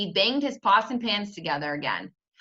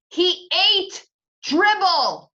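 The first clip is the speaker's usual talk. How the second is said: slow, loud, much higher insistence; insisting loudly; 10 semitones higher, 7 dB louder, much slower than usual. Acted. Peter insisting to his mother, shouting. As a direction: hammer it home. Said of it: "I insisted".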